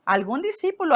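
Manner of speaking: usual speaking voice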